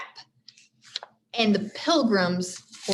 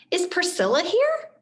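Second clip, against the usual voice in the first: put-on eager girl; 10 semitones higher than usual. Annie asks eagerly.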